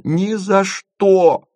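high, drawn-out cry of refusal; exhausted cry. A narrator's reading. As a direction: cry out with your last strength. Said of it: "из последних сил выкрикнула Маша". (выкрик из последних сил)

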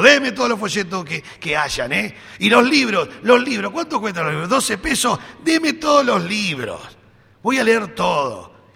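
hammed-up enthusiasm, grabbing everything; overexcited churchgoer wanting every book; mock-eager believer. Eager believer wants all books.

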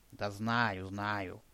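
put-on grumpy boy muttering he already knows; grumbling. A narrator's reading. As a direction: mutter it under your breath, fading out. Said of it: "пробурчал мой спутник".

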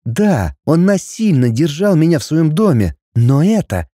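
ordinary delivery

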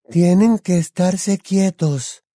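put-on high, slow, airy girl's voice; Luna. A narrator's reading. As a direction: call politely, stretching the name. Luna speaks while cutting ropes.

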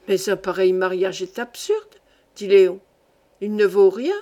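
a hint of the dismissive fiancé, voice dropped; objecting fiancé. A narrator's reading. Léon tells her the marriage is absurd, mildly dismissive.

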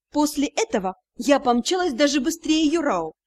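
usual speaking voice

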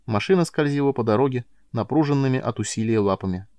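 ordinary delivery

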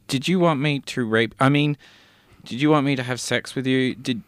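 ordinary delivery

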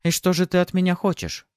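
high, caught-off-guard woman; taken-aback woman. A narrator's reading. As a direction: lift the voice, a little thrown. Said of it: "Она даже опешила".

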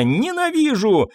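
high, drawn-out cry of hatred; hateful outburst. A narrator's reading. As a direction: lash out, stretching the words at a high pitch. Mother attacks the man she blames.

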